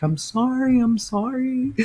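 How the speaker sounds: put-on high, sobbing little boy's apology; imitating a sobbing boy. A mother scolds her little son, who sobs out a high-pitched apology. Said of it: "he's really sobbing".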